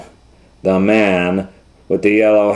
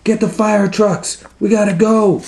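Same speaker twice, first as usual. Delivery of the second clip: urgent firemen; 13 semitones higher, 3 dB louder than usual. Acted. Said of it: "They go".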